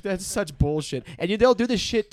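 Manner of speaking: ordinary delivery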